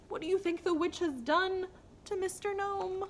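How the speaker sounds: lively storybook voice with figures; reading to children. A narrator's reading. Teacher reads a picture book to young children, lively. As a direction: read it bright for little ones.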